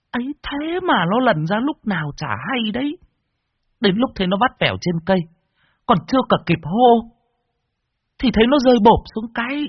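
high-pitched, distressed telling; agitated recounting. A narrator's reading. Neighbour tells the old woman how the boy fell, agitated. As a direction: blurt out the accident as a shaken witness. Villager recounts witnessing the fatal fall.

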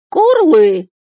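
high, loud creature call; dragon exclamation. A narrator's reading. Dragon exclaiming at her snail facts, loud call. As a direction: let the call burst out high and long. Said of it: "выдохнул мой странный собеседник".

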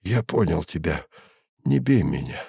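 low, soft, hoarse plea; hoarse reply. A narrator's reading. Beaten prisoner answering the centurion, hoarse and subdued. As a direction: answer hoarsely, voice dropped and weak. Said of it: "ответил хрипло".

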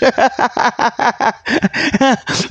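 long amused laugh. Real, not acted. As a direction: laugh at the sas pun. Laughs at SAS joke.

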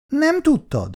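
high, sharp angry flare-up; angry snap. A narrator's reading. Wife flaring up angrily at her husband. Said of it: "Csattant fel Irén hangja, most már dühösen".

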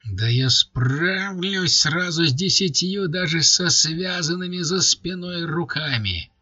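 slow, high, wound-up bragging; high contralto. A narrator's reading. Ryzhy boasting in the tavern, high contralto squeal. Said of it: "а там и высокий контральто".

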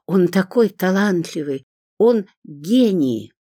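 high, swooning admiration; fluttering adoration. A narrator's reading. Secretary adoring her idol, fluttering. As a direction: gush slowly, lingering on the praise. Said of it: "затрепетала секретарша".

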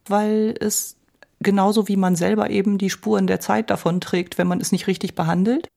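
usual speaking voice

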